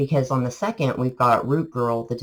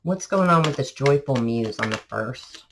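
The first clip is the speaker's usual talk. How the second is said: ordinary talk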